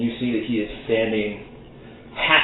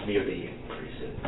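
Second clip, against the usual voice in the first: quiet correction; 2 semitones lower, 11 dB quieter than usual. Acted. Faust correcting Animus, quiet and cool. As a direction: murmur it coolly. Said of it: "shakes his head".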